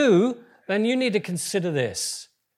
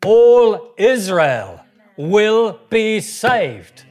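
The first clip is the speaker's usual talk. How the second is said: slow, loud and higher, drawn out; preaching proclamation; 6 semitones higher, 13 dB louder, much slower than usual. Real, not acted. Preacher leading the congregation, slow booming proclamation. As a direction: proclaim it with full force to the room. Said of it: "Come on, just say it with me".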